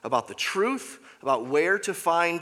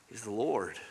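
normal talking voice

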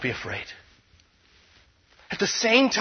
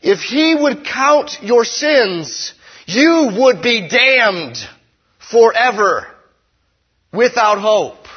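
slow, heavy and louder; solemn dire warning; 5 semitones higher, 7 dB louder, much slower than usual. Acted. Preacher warning the congregation of damnation, slow and loud. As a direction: drive home the doom slowly.

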